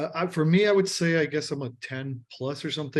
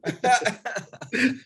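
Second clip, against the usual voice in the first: laughing; 11 semitones higher, 4 dB louder than usual. Real, not acted. Laughing at own Baby Shark answer.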